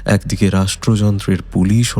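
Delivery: plain reading voice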